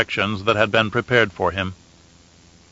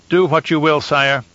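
strident; 5 semitones higher than usual. A narrator's reading. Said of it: "his voice more strident than".

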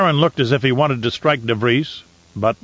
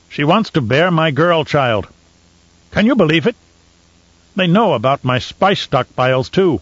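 a Baron's rising outrage, pitched higher; indignant Baron; 4 semitones higher than usual. Acted. Baron ranting to De Vries, outraged. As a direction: sputter in disbelief. Baron rants about the witch.

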